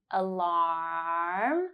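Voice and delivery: "Alarm" with the ah held long. Shown as a demonstration. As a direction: stretch the final ah of "Alarm". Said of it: "hold ah for three seconds".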